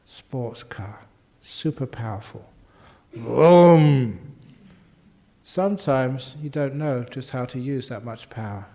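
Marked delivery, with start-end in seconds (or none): 3.1-4.3 engine vroom. Retold or imitated. Imitating a powerful sports car.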